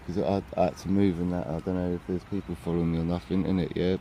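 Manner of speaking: hushed, wary speech; keeping voice down. Real, not acted. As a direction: talk low so no one hears. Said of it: "Keep my voice down".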